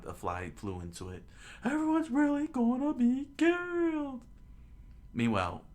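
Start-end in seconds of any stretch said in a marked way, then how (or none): 1.6-4.2 high falsetto yell. Acted. Villager yells out a warning that everyone will die, alarmed yell. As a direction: cry out loud in a squeaky high voice. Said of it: "yelled out".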